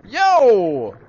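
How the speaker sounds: high, loud "Yo"; shouted call. Acted. Game kid hailing the player, shouted call. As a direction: holler it like an excited kid.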